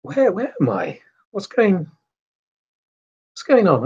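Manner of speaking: high, bewildered waking voice; a woman just back from the dead, confused; lightly voiced confused patient; dazed waking. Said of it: "just simply goes".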